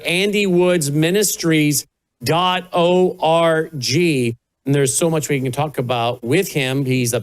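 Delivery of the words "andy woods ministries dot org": slowly, every word clear; slow, spelled-out repeat. Shown as a demonstration. Host repeats the website slowly for listeners. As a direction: give the web address slowly so listeners can write it. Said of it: "the website is andy woods ministries dot org".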